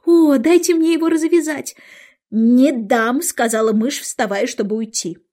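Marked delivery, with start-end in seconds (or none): 0.1-1.8 high, loud, eager plea; eager to help. A narrator's reading. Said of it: "всегда готовая оказать услугу". 2.3-3.2 loud, offended refusal; firm refusal. A narrator's reading. Offended mouse refuses Alice.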